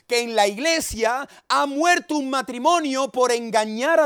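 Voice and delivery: high, loud pulpit thundering; full-force sermon shout; fiery preaching. Acted. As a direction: preach it out to wake the whole neighbourhood.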